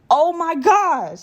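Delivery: slow, high, drawn-out amazement; stunned oh my gosh. Real, not acted. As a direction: stretch it out in real disbelief. Overwhelmed by snake discovery.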